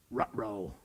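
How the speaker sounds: low, soft Scooby-style ruh-roh; caught-out cartoon dog. Student caught cheating.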